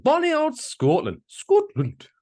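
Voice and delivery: very high, hammed-up Scottish accent; mock Scottish accent. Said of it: "trying to do a Scottish accent".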